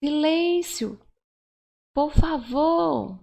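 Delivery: high, insistent plea to a room; calling for attention. Shown as a demonstration. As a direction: call out for silence, stretching the vowels. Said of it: "você quer chamar a atenção".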